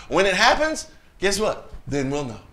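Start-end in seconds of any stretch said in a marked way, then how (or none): none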